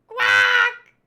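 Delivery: high, very loud quack; duck squawk. Acted. The duck Karu answering, loud squawk.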